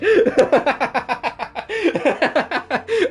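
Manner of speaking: long, high-pitched laughing fit; helpless laughter. Real, not acted. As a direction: keep cackling, unable to stop. Cracks up at own reading.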